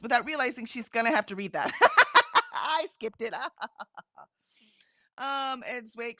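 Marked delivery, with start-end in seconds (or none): 1.8-2.5 sudden squeal of a laugh, much higher and louder. Real, not acted. Host squealing with laughter at the chat, a high real laugh.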